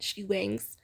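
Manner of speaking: ordinary talk, nothing marked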